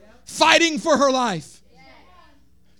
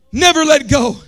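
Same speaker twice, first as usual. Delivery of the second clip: loud first take; 8 dB louder than usual. Shown as a demonstration.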